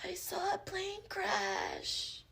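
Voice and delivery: high, hushed, drawn-out impression; Natasha Lyonne. Actress imagined in the movie's role, slow hushed impression. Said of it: "Natasha Lyonne would have been like".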